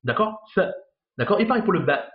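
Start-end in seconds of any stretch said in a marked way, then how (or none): 0.5-0.6 "th" as a bare consonant, no vowel after it; just the start of "th", tongue between teeth; blocked consonant. Shown as a demonstration. Teacher showing a letter with soukoun, "th" blocked without a vowel. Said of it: "il y a un soukoun".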